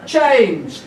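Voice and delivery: high, ringing protest call; rallying cry. Acted. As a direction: call it out to a crowd. Rallying cry in performed poem.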